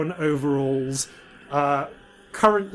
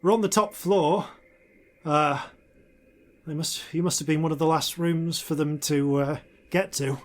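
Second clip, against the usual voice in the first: maintenance woman voice; 4 semitones higher than usual. Acted. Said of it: "is Tully Scourer, uh, the human woman".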